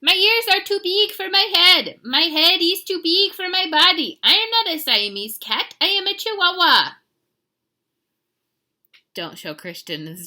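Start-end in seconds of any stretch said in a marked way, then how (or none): 0.0-6.9 high, loud mock Spanish accent; cartoon Chihuahua with a Spanish accent. A narrator's reading. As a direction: declare it proudly in a hammed-up Spanish accent. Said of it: "using his very best Spanish accent".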